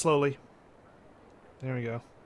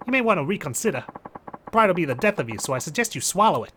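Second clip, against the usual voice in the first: higher-voiced Jersey mobster; Jersey accent; 4 semitones higher, 3 dB louder than usual. Mobster warning a proud fighter, Jersey accent. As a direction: press him in a Jersey accent. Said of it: "Japanese mafia member has a Jersey accent".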